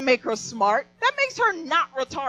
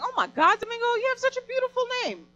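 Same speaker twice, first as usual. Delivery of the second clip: gushing admiration; 11 semitones higher, faster than usual. Retold or imitated. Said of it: "I was like".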